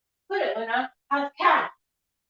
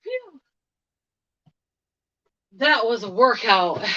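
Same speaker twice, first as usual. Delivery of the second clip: panting after heavy lifting; breathless, words between breaths; 4 dB quieter than usual. Real, not acted. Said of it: "let me catch my breath".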